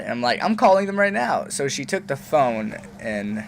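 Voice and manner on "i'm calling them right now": higher defiant threat; defiant teen. Retold or imitated. Son defies his mom, high and huffy. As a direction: dare her to stop you.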